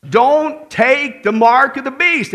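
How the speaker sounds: slow, loud, each word weighted; solemn loud warning. Real, not acted. Preacher warning his listeners, slow and loud. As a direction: drive the warning home, slowly and loudly.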